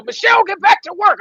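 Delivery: loud, high bossy order; imitating a boss ordering her back to work; mock boss. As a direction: bark it like a stern boss. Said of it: "That's his cue to go".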